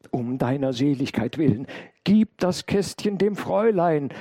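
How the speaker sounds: high, loud plea, building; urgent call. A narrator's reading. Intruder hands the maid a casket as he flees, urgent call. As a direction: implore loudly, climbing in pitch. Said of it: "rief der Mensch".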